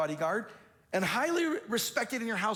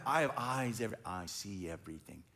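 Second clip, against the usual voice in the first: much lower, softer, ominous all-seeing voice; ominous king; 10 semitones lower, 5 dB quieter than usual. Retold or imitated. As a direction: drop low and quiet, like a king who sees everything.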